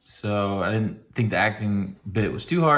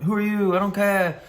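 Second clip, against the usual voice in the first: high mock British officer brushing him off; dismissive British colonel; 8 semitones higher than usual. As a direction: play a snooty British colonel. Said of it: "the colonel's like".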